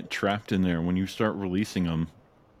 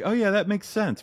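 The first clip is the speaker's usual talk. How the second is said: realization; 13 semitones higher, 4 dB louder than usual. Retold or imitated. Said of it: "I was just like".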